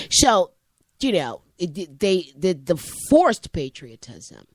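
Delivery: normal talking voice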